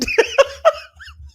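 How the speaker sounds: high, loud cackle; real laughter. Real, not acted. Host reacting to viewer's joke, high loud laughter.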